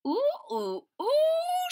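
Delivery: high, lilting mother's coo; mother's ooh. A narrator's reading. Mother peers into her son's mouth, cooing. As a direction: coo over the loose tooth.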